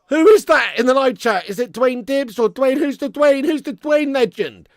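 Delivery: loud, high calls into the chat; excited calling out. Real, not acted. Streamer calling to the live chat to find the donor, loudly. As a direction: shout out asking who the legend is.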